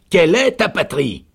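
loud, high sergeant's demand; insistent repeat. A narrator's reading. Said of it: "Le sergent répéta". (répétition forte et aiguë du sergent)

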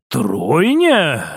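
low, doubtful echo; lightly voiced man's disbelief; frowning prince. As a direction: question it low, taken aback.

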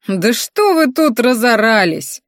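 put-on understated yell of an annoyed older woman; mild shout. A narrator's reading. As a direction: holler down, in a restrained acted way. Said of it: "вдруг закричала открывшая окно".

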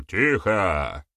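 ordinary delivery

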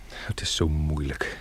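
soft, slow whispered sigh; anguished whisper. Acted. Man struggling to confess his love, slow whisper. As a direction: breathe it out as if it hurts to say.

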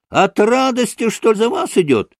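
put-on high, boyish shriek of glee; childlike ringing cry. A narrator's reading. Kalganov mocks Maksimov's bride story, childlike ringing cry. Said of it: "завопил каким-то детским звонким голосом".

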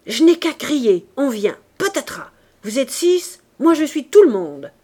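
put-on girl threatening six bandits, loud. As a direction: warn them she can raise the street. Éponine threatens to scream.

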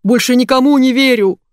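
loud, high-pitched outburst; raging. A narrator's reading. Boss who feels deceived, raging at everyone. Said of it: "бушевал Лавиткин".

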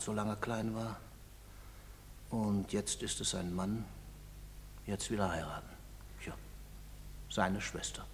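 soft, low, bitter murmur; bitter and quiet. Acted. A father imagines the town's mockery of his son, quiet bitterness. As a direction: let it sink, low and bitter.

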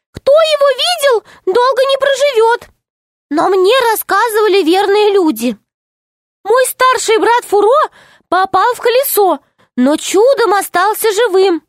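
high, earnest little gnome; tiny gnome voice. Acted. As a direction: explain it like a small nervous gnome. Gnome retells. (тоненький голос гнома)

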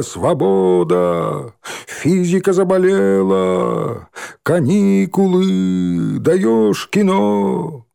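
high, drawn-out gleeful shouts; shouting. Acted. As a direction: yell it out, stretching each word slowly. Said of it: "орали, перебивая друг друга".

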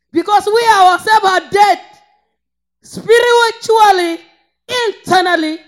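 forceful, exhorting preacher; full-force preaching. Real, not acted. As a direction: drive the rebuke home at a shout.

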